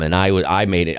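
usual speaking voice

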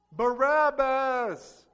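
slow, loud drawn-out crowd cry; crowd shout. Acted. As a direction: bellow the name like a mob. Crowd's answer acted out.

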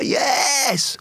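high, bursting cry of joy; overjoyed yes. Retold or imitated. Hearing his girlfriend is pregnant, a high joyful yell. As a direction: shout it out, he's going to be a father.

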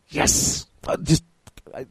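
a breathless, unvoiced cheer; excited whisper. Real, not acted. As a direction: gasp a thrilled yes. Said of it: "I get so excited when anybody mentions the Emperor".

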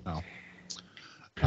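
breathy unvoiced no, barely audible; whispered no. Real, not acted. Whispering an aside to the co-host. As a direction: drop to a whisper for a quick aside. Aside during listener email reading.

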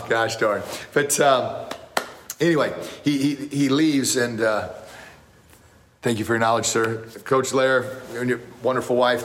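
ordinary talk

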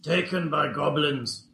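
lightly voiced goblin, broken speech; a hint of a simple goblin. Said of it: "That should make easier goblin voices".

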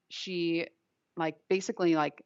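ordinary talk

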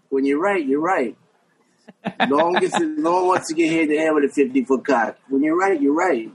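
a meek sidekick chiming in; little voice. Retold or imitated. Frankie agreeing with his buddy on the tape, little high voice. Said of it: "this little voice go".

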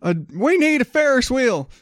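high, hammed-up preacher pitch begging for money; mock televangelist. Comedian mocking a televangelist asking for church money, high hammed-up preacher voice. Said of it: "Is going out there saying".

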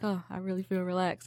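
soft, drowsy, fully relaxed; relaxed sigh. Retold or imitated. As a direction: breathe it out, deeply relaxed. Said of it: "it's like".

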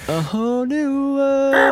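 high falsetto, slow singing; girl voice. Pretend. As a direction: sing it in a high girly falsetto. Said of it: "Isn't that annoying?".

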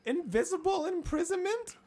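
put-on high, urgent frightened girl; Jane voice. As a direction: keep it high and anxious. Keeps Jane voice for story.

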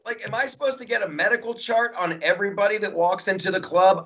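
high, laughing disbelief; incredulous, laughing it out; exasperated. Retold or imitated. Comic defending Chris Rock, high laughing disbelief. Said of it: "and I'm like".

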